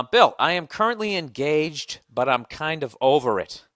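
loud, slow, deliberate reading; email read-out. Acted. Host reading a listener's relationship question, slow read-out. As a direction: read it out slowly and emphatically.

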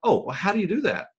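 high and quick with curiosity; eager question. Retold or imitated. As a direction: fire it off, fascinated. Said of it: "they will say".

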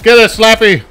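high, loud attack order; battle command. Pretend. Gamer unleashing Slappy, shouted battle command. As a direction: yell it to set Slappy on her. Sets weapon on game enemy.

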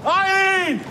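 shouting her name across the house; a worried, rising call. Acted. Searching, calling her name out.

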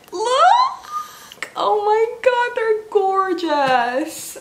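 high squealed look, then a slow drawn-out gorgeous; delighted squeal. Real, not acted. A YouTuber squealing to viewers over gifted books. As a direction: shriek with joy and stretch out the praise.

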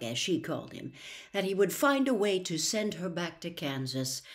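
ordinary delivery

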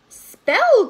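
high, loud witch's call; witch's cry. A narrator's reading. Storyteller voicing witch to young listeners, crying out. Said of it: "cried the witch".

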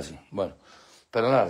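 ordinary delivery

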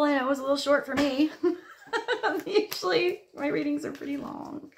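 high, giggly, laughing through the words. Real, not acted. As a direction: laugh it off lightly. Laughing at her own short reading.

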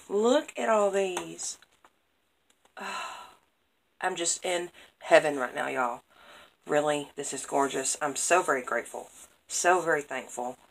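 normal talking voice

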